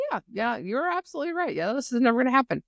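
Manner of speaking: high, fast, rattled-off agreement; brisk mock agreement. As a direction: rush through it, acting out her agreement. Retold Niari readily agreeing.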